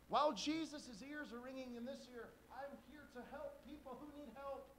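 ordinary delivery

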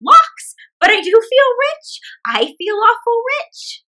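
very high and loud, gleeful; giddy joy. Acted. Old woman feels rich, gleeful shout. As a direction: crow about being rich. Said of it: "she began saying".

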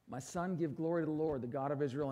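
ordinary talk

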